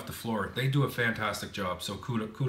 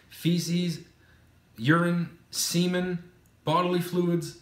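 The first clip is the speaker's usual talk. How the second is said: disgusted emphasis; 7 semitones higher, 4 dB louder, slower than usual. Real, not acted. Listing gross messes maids clean.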